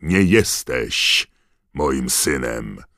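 very slow, loud disowning; furious, heavy rejection; roaring. A narrator's reading. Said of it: "ryknął pan Crouch".

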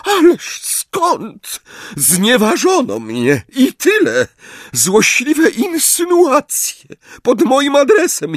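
high, slow, breaking voice; tearful, wounded. A narrator's reading. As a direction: play the martyr, voice cracking. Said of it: "dramatycznym, załamującym się głosem". (łamiący się, płaczliwy głos, wysoko)

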